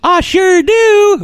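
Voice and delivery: high, slow, loud mock Southern gal; hammed-up squeaky drawn-out cheer; Anna Paquin impression. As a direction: put on a silly high Rogue voice. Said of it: "That is not what Anna Paquin sounds like".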